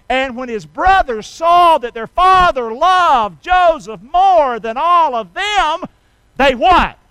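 ringing pulpit delivery, pausing for answers; forceful preaching. Acted. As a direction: preach the verse at full force, drawing it out.

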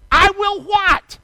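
loud, high, drawn-out demand; incredulous question. Acted. Preacher puzzling over a vow left blank, loudly incredulous. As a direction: throw the question out loudly, stretching each word.

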